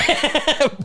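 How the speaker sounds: sudden high-pitched laugh; real laugh bursting out. Real, not acted. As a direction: let out a quick high laugh. Laughs after friend's jab.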